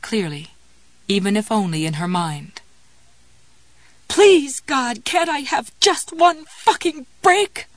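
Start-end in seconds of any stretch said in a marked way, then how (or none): 4.1-7.7 high, loud desperate scream. A narrator's reading. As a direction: scream it at the sky, slowly. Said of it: "she screamed".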